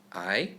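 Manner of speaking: I rising upward, second tone. Shown as a demonstration. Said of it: "For tones".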